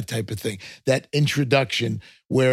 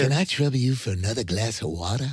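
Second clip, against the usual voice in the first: quick, polite Southern voice; light put-on Southern accent; faster than usual. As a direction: ask like a courteous Southerner. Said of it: "in the South".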